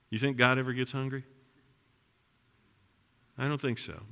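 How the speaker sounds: normal talking voice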